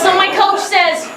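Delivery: ordinary talk, nothing marked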